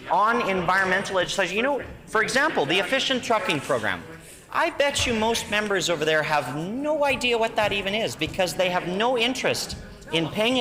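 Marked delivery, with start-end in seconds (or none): none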